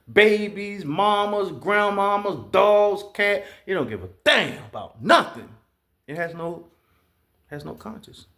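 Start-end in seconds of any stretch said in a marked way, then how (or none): none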